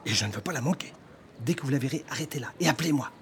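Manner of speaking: plain reading voice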